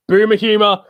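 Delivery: loud, high teasing call; mocking shout. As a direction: taunt the friend with it.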